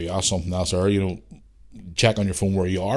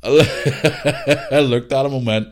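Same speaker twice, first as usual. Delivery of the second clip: ordinary talk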